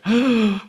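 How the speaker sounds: loud, high gasp; exaggerated intake of breath; mock shocked gasp. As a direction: gasp in pretend revelation. Mock shock at initials' double meaning.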